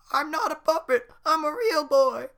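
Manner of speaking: put-on very high loud boy voice; Pinocchio voice. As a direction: insist you're real. Pinocchio protests.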